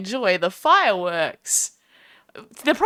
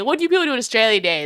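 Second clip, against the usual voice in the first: ordinary delivery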